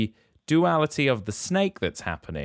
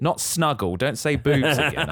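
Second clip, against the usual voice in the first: real laugh in the correction; 5 semitones higher, 3 dB louder than usual. Real, not acted. Host corrects Rob's mishearing, laughing. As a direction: tease him while laughing, a bit higher.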